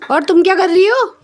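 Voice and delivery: high, loud teasing call; loud high call to the pet. Real, not acted. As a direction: shout over to the cat. Calls out to the cat.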